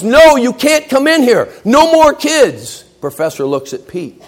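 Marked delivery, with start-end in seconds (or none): 0.0-2.8 much higher, louder, dragged-out no; the class yelling at the door; class shouting. Acted. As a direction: students shouting to keep newcomers out. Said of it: "and the class goes".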